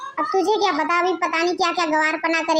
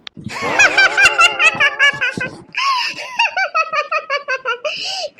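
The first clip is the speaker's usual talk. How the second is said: raucous laughter; 8 semitones higher than usual. Acted. Daughter-in-law mocking her country guests, raucous laughter. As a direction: burst into helpless hooting laughter. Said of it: "गला फाड़ फाड़ के क्यों हंस रही है".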